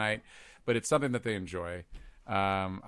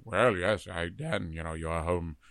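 lower, softer, calm reassurance; Patrick Stewart reassuring; 5 semitones lower, 4 dB quieter, slower than usual. Shown as a demonstration. Captain comforting Dan home alone, low and reassuring. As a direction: soothe yourself like a kind captain. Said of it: "They're all very reassuring".

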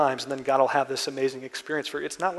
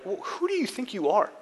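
high, indignant mock outrage; the crowd rounds on the criminal in hammed-up indignation; incredulous; 5 semitones higher than usual. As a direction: scold him as an upstart who doesn't belong. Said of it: "absolutely incredulous".

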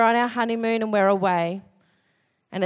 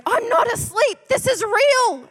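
indignant; 12 semitones higher, 3 dB louder than usual. Retold or imitated. Angry husband protests to his wife, high indignant insistence. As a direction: insist it hotly. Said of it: "he got so mad at me".

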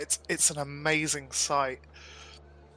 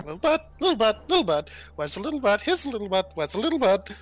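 put-on high, dotty old woman, sing-song and quick; old tabaxi voice; 8 semitones higher, 5 dB louder, much faster than usual. Old tabaxi searching for the little bird, high and dotty. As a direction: chant it like a confused old crone.

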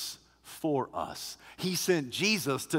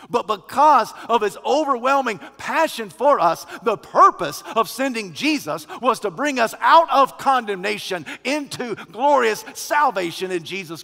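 higher, louder preacher's proclamation; full-force preaching; 7 semitones higher, 9 dB louder than usual. Acted. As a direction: proclaim it with rising fervour.

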